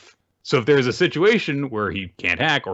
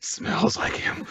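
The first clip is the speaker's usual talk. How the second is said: breathy whispered joke, a little higher; teasing whisper; 10 semitones higher, 6 dB quieter, slower than usual. Pretend. Cohost joking about Fumino sniffing the gift, whispered. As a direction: whisper it like a secret swoon.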